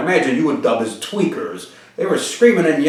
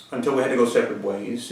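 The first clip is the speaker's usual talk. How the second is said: normal talking voice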